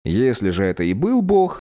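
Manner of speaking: ordinary delivery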